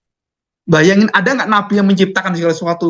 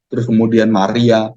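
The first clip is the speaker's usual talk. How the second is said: ordinary delivery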